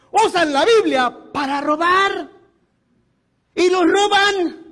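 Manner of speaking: preacher rising against thieves; slow indignant outcry. Real, not acted. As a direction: hammer it home slowly.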